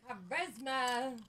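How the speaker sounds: drawn out. Acted. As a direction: stretch charisma out slowly. Echoing the word slowly.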